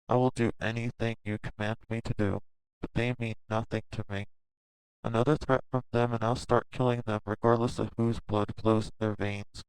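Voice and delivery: quiet menace. Acted. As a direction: hush the threat, deadly calm. Menacing vow.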